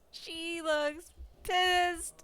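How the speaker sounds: very slow drawl. Real, not acted. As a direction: stretch each word out slowly. Says the line twice, slowing down.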